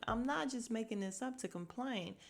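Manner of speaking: usual speaking voice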